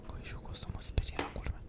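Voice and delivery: very soft, voiceless whisper. Real, not acted. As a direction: whisper the confession of being scared. Whispers, then repeats aloud.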